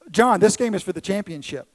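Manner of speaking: a little boy bragging, lightly voiced; boastful kid.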